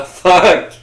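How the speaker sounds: sudden loud high outburst; surprised shout. Real, not acted. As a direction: blurt it out as it dawns on you. Sudden realisation mid joke. (juron crié de surprise)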